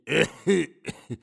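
high, loud acted cough; old man's cough. Old husband coughs before going to bed, loud cough. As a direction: break off into coughing.

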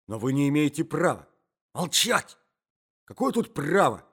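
high, loud indignant exchange; heated quarrel. Acted. Young man silences the protesting husband, indignant and high. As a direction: cut the objection down angrily.

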